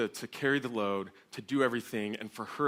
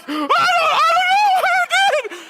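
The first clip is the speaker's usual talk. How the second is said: high, loud, drawn-out wail of panic; acting out a terrified kid; panicked screaming; 14 dB louder than usual. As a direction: scream back in helpless terror. Terrified son answers screaming mother.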